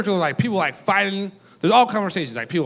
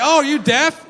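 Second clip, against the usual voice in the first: dumb question; 7 semitones higher, 4 dB louder, slower than usual. Retold or imitated. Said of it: "I was like".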